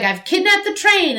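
gleeful villain. Retold or imitated. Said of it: "and he's like".

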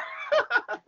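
short high-pitched laugh; high giggle. Real, not acted. Host laughs after apologising for ranting, high giggle. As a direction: break into a squeaky laugh.

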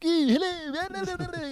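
very high, drawn-out squeaky hello; stage impression. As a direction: stretch it out in a thin high squeak. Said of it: "he's all like".

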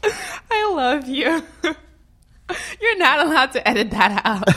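overwhelmed, laughing while tearing up; laughing and crying. Real, not acted.

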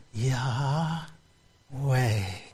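two syllables, one per slow breath; breathed Yahweh. Shown as a demonstration. Preacher showing what breathing in says, breathed softly. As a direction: softly breathe Yah, pause, then way. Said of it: "Every time you breathe in".

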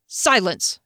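ordinary delivery

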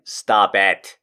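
ordinary talk

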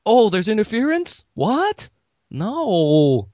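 high-pitched, hammed-up surprise; mock disbelief. Movie character on a pipe call, in high mock disbelief. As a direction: swoop up in feigned shock, then draw out the denial. Acts pipe talk, reprises meme.

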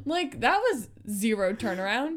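normal talking voice

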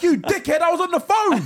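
high, loud insult through a laugh; angry retort. Retold or imitated. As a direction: snap back at him, laughing. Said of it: "I'm like".

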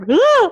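high, loud shocked yelp; mock panicked shriek from the public; alarmed shriek. People reacting to deadline.

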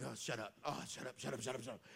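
sleepy groan at the phone alarm; annoyed at the alarm. Retold or imitated. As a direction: mutter it at the ringing alarm. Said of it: "and it's like".

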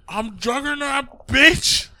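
high, drawn-out mock battle cry; hammed-up slow, high-pitched boast; Juggernaut catchphrase. Quotes a film catchphrase.

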